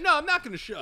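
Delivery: high, loud defiant no; mock refusal. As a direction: refuse loudly. Victim refusing shush.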